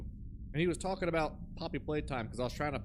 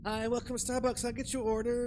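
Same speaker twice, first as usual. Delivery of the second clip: a hint of a barista trailing off; imitating a barista's style of talking; mock barista voice; 6 semitones higher than usual. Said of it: "particular way that people talk".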